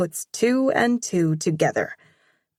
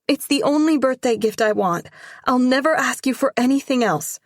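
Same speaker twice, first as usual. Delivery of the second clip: high, louder, fast at the end; pleading; 7 semitones higher, 4 dB louder, faster than usual. Acted. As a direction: beg her mom breathlessly. Girl begs mom for cat.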